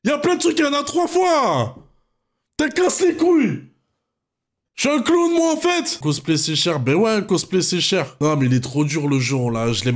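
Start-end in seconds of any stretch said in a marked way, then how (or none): 0.0-6.0 furious shout. Real, not acted. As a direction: rage out at bad luck, voice cracking high. Rage contre la roue tombée. (hurlement furieux contre la roue)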